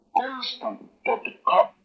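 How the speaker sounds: Constant, totecap said high, the end breathed out; demo word. Shown as a demonstration. Teacher demonstrates the word to the class, high. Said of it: "Y se pronuncia así".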